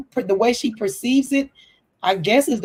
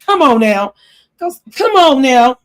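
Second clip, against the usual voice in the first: exasperated; 4 semitones higher, 7 dB louder than usual. Real, not acted. Scolding Lisa on the podcast.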